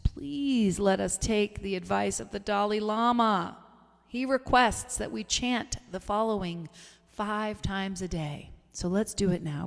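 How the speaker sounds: ordinary talk, nothing marked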